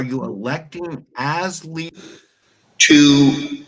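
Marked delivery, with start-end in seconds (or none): 2.8-3.6 heavy echo on the mic; echo chamber. Real, not acted. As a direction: voice booming with reverb. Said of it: "You sound like you're in an echo chamber".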